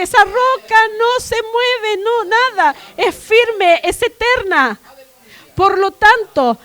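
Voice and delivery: loud, driving sermon climax; fiery preaching. Acted. Preacher to the church, loud and unshaken. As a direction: proclaim it with full force to the congregation.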